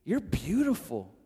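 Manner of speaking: soft, hushed pleading with the cat; tender to the cat. Retold or imitated. Man softly pleading with his pregnant cat, tender. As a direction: coax her gently, half laughing.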